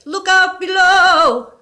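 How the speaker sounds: high, loud, slow booming call; booming ghost. A narrator's reading. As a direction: boom it down the chimney. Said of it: "the voice boomed".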